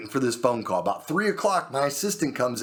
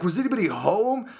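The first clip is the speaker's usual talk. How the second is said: high, worried question; incredulous, pitched-up question; alarmed; 7 semitones higher than usual. Retold or imitated. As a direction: replay your alarm at the news. Retold alarm at the shooting.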